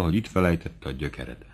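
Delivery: ordinary delivery, nothing marked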